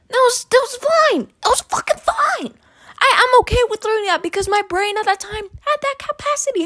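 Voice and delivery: high, heated, rising rant; getting angry. Real, not acted. Ranting to viewers about sixth grade, high heated outburst. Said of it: "Now, I'm getting angry right now".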